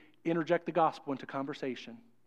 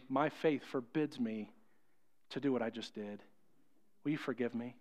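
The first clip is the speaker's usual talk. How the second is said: humble quiet apology; 5 semitones lower, 8 dB quieter than usual. Retold or imitated. Doctor Gibbs apologizing to the woman, soft and humble. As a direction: lower your voice and ask forgiveness gently.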